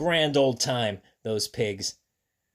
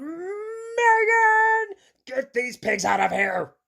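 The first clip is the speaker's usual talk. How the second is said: high, drawn-out storybook holler; principal's yell; 3 dB louder, much slower than usual. Acted. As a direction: holler the name long and high. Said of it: "The principal yelled".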